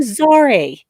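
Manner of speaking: "Zare" said in her odd way; imitated pronunciation. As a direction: mimic her weird way of saying "Zare". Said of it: "She pronounced his name".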